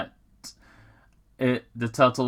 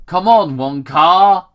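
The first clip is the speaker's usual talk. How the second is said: questioning Tom; 6 semitones higher, 10 dB louder than usual. Acted. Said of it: "Tom questioned".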